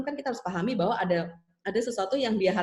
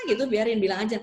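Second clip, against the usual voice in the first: ordinary delivery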